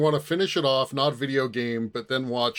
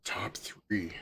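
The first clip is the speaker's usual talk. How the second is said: under the breath, thinking aloud; whispered musing; 3 semitones lower, 12 dB quieter than usual. Real, not acted. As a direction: whisper it to yourself while guessing. Musing to self on the quiz.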